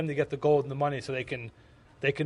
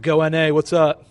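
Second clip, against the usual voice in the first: loud rallying shout; team cheer; 12 dB louder than usual. Real, not acted. Cheering on his North American team, loud shout. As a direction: yell it like a battle cry for your team.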